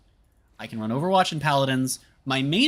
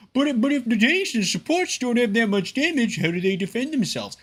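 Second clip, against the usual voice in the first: mocking whiny gamer; 10 semitones higher than usual. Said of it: "Overwatch players be like".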